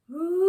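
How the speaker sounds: long, high ghostly "ooh"; ghostly moan. Shown as a demonstration. Yoga leader releasing a breath as a ghost, long moan. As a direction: breathe out in a sustained spooky moan. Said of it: "you let out a ghostly moan".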